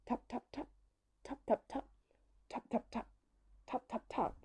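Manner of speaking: very soft, high, crisp taps; hushed, staccato woodpecker sound effect; imitated tapping. A narrator's reading. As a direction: tap out the words lightly in threes. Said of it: "a sound that goes".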